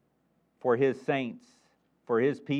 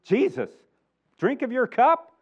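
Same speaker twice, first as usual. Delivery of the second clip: higher, louder eager reply; overconfident disciples; 7 semitones higher, 4 dB louder, slower than usual. Retold or imitated. Disciples answering Jesus about the cup, eager and higher. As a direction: brush it off like it's easy. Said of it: "They have confidence".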